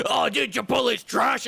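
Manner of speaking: high, loud, scoffing dismissal; mock food snob. Streamer mocking a heated food snob, scoffing. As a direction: sneer it like a fast-food purist. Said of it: "and someone".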